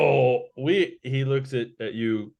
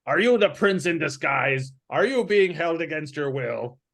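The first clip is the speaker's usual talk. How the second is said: put-on higher-pitched questioning as the old man; old man with a hook, demanding; 4 semitones higher than usual. Old man with a hook questions a stranger, demanding. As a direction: challenge them as a suspicious old man. Game master voices old Arthas.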